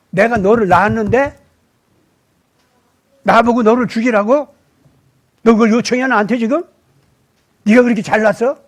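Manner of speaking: loud, indignant scolding as God; God's angry rebuke. Acted. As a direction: demand it like an offended father. God rebukes Job in sermon. (욥을 꾸짖는 하나님, 크게 화난 목소리)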